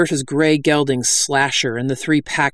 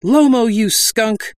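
higher, drawn-out angry cry; indignant outburst; 8 semitones higher, 4 dB louder, slower than usual. A narrator's reading. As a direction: spit out the insult slowly. Heroine confronts the bandit.

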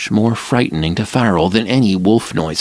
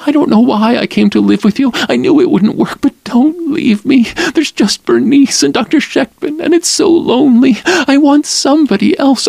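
high, loud, rushed tearful begging; a narrator's hammed-up weeping plea; tearful pleading; 4 dB louder than usual. Lila begging Farrell to stay, weeping and pleading.

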